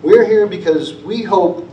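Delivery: fighting back tears mid-sentence; choked up. Real, not acted. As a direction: voice catching with emotion. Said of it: "I'm not one that gets choked up".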